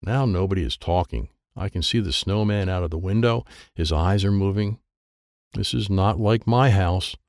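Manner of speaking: plain reading voice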